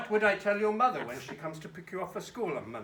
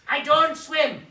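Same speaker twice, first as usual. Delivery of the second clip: loud, higher-pitched protest; indignant refusal; 6 semitones higher, 12 dB louder, slower than usual. Acted. Redbird refusing the duck's swim, loud and indignant. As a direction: punch it out as a firm refusal.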